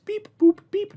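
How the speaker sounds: high beeping robot voice. Pretend. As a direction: beep it out like a little robot. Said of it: "That's 'cause I'm an X R P bot".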